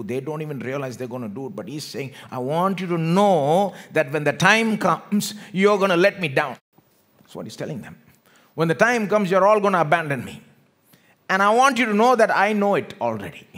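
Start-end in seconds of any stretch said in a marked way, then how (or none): 2.3-6.6 forceful preaching. Acted. Jesus warning his disciples, loud forceful preaching. 8.5-13.5 raised, loud preacher's voice; forceful preaching. Acted. Jesus telling disciples of their abandonment, loud forceful preaching.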